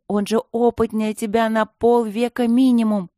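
put-on a young girl scolding her friend. A narrator's reading. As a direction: tell him off, worried and cross. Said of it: "ругала Алинка Матвея".

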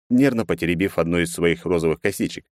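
ordinary delivery

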